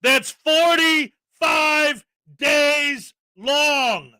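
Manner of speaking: very slow, loud, each word stretched; drawn out emphasis. Real, not acted. Telling viewers about the footage, slow and emphatic. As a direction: hammer every word slowly. Stressed disbelief at footage length.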